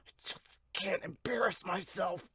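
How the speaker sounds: whispered, struggling student; strained whisper. Acted. Student fighting sleep vows not to embarrass himself, strained whisper. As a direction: force it out in a tense whisper.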